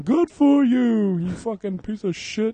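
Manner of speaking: slow, high, loud mocking rant; ranting actor. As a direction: ham up the on-set meltdown, drawn out. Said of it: "went on that rant".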